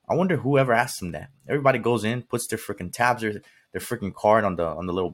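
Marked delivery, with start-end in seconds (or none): none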